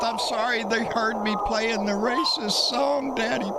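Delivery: high, pleading mock whine; a taunting parody of a scared kid pleading; mock begging child. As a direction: beg daddy in a sneering childish voice. Mocking neighbour begging his father.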